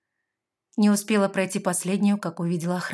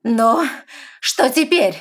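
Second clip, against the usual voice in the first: loud, high furious cry; angry shout; 6 semitones higher, 10 dB louder, slower than usual. A narrator's reading. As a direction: yell it out in anger. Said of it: "гневно прокричала".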